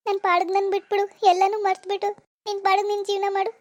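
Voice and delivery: desperate plea between sobs; acted weepy imploring; tearful begging. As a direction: beg him tearfully to let you be. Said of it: "ಅಳ್ಬೇಡ, ಅಳ್ಬೇಡ, ಹೋಗು".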